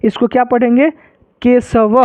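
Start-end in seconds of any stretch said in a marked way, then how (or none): 1.4-2.0 "केशव" with an h sound after the final va; visarga pronunciation. Shown as a demonstration. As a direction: end "केशव" on an open, aspirated vah. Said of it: "ह बोलना है". ("केशव" के अंत में ह का उच्चारण)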